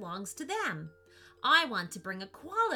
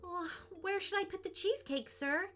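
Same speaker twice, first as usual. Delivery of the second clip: nervous; 11 semitones higher, faster than usual. Acted. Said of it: "smiled nervously".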